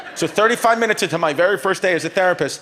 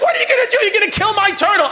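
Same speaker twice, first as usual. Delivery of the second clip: much higher, louder hammed-up scream; screaming wife; 3 dB louder, faster than usual. Wife screaming as the therapist whacks her tortoise. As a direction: shriek it at him in mock horror. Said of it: "she's screaming at me".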